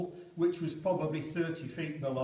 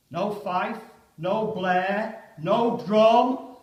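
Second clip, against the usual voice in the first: from raised to loud and high; building declamation; 5 semitones higher, 10 dB louder, much slower than usual. Acted. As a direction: build to the charge. Poem recited, building.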